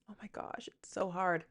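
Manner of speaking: real near-whisper, drained; overwhelmed. Real, not acted. Podcaster admitting how hard this is, in an overwhelmed hush. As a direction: sigh it out softly, struggling.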